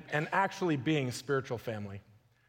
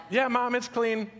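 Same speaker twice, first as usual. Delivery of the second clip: put-on child's voice, higher; 6 semitones higher than usual.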